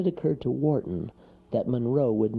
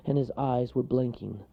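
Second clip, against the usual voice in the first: ordinary delivery, nothing marked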